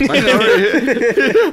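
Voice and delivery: high laughing reply; giggling through the words. Real, not acted. Laughing after a joke.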